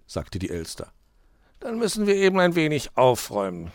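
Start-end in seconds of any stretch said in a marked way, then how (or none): 1.6-3.7 mildly grumpy fox, raised voice; grumbling. A narrator's reading. Fox answers the magpie's complaint, grumbling. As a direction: grudgingly concede the chore. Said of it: "knurrte der Fuchs".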